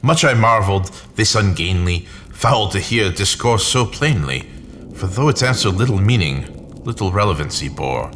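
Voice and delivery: ordinary delivery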